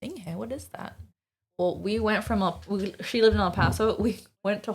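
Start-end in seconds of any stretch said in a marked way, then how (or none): 0.0-1.0 much softer retold question; puzzled mom. Mom questioning the cousin's story, quietly puzzled. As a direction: quietly imitate a baffled parent. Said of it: "And my mom's like".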